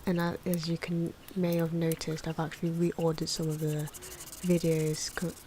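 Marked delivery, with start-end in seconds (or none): none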